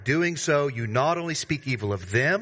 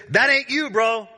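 much higher, louder, emphatic call-out; fired-up preaching; 8 semitones higher, 6 dB louder than usual. Acted. Preacher confronting the congregation, fired-up and loud. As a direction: drive it home like a buddy calling you out. Preacher punching the implication home.

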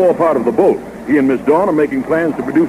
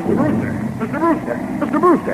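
high urgent calls of his name; calling out; 10 semitones higher, 4 dB quieter than usual. Acted. Skipper calling the boss urgently across the deck, high calls. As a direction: call him across the deck, more insistent each time.

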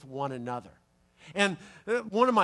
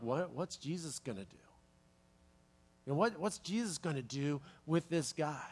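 hushed, puzzled aside; wondering disciples, quiet; 4 semitones lower, 9 dB quieter than usual. Retold or imitated. Disciples whisper to each other, hushed and puzzled. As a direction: murmur it like a stunned onlooker.

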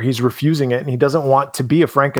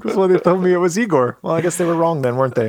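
Igor voice; 5 semitones higher than usual. Retold or imitated. S0 voices Igor's reply.

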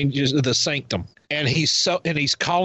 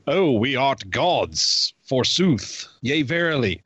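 slow, grand mock-Shakespearean voice; hammy stage actor; slower than usual. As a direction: ham up an old-English god. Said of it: "a huge Shakespearean element to it".

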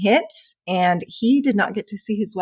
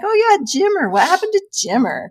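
surprised recall; 11 semitones higher than usual. Retold or imitated. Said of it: "I was like".

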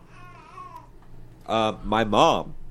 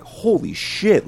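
retold high, drawn-out amazement; amazed exclamation; 10 semitones higher, 5 dB louder, slower than usual. Host recalls tasting Tootsie Rolls, amazed. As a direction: exclaim it slowly and high, in awe.

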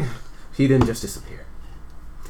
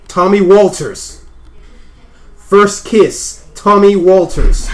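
drawn out loud and slow; slow emphatic; 4 semitones higher, 7 dB louder, much slower than usual. Acted. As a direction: lean on each name slowly. Slow emphatic name repetition.

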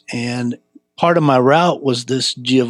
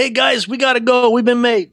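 high-pitched alarm to the gang; retold urgent warning; 12 semitones higher than usual. Caught young shoplifter alerts his buddies to flee, urgent. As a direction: act out calling friends to run. Boy warns friends to flee.

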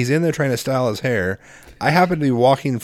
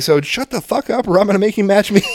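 laughing threat; 8 semitones higher than usual. Retold or imitated. Retold threat while laughing.

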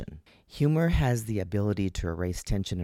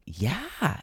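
eager, upbeat yes a little higher; encouraging yeah; 6 semitones higher than usual. Retold or imitated. Husband eagerly reassuring his wife, upbeat yes. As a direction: cheer her on without a clue. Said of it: "enthusiastic and really encouraging".

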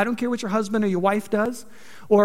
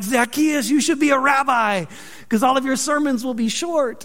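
a higher, teasing voice of a heckler; mocking jokester; 3 semitones higher than usual. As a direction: lightly needle him like a schoolyard tease. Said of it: "heard all of the short jokes".

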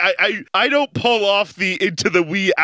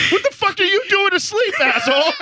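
high-pitched, indignant outburst; hammed-up scolding, pitched way up; mock yell back; 12 semitones higher than usual. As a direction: yell it back in playful outrage. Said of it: "I almost wanted to yell at you back".

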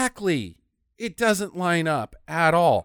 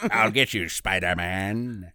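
Green Goblin; 14 semitones lower, 5 dB quieter than usual. Retold or imitated. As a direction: growl a cartoon villain's threat. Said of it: "the Green Goblin still sucks".

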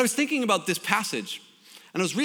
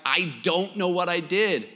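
imitated reply, spoken up to be heard; pleading repeat. Son repeating himself to his mom, pleading protest. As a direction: repeat it more clearly, in protest.

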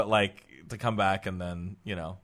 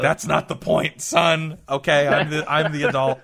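louder, higher mock dad protest; exasperated dad; 6 semitones higher, 10 dB louder, slower than usual. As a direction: assert your parental authority over the kid, hammed-up. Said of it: "I'm gonna be like".

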